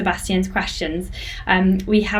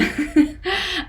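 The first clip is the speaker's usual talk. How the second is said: amused laugh; 10 semitones higher than usual. Real, not acted. Laughing at her own accent.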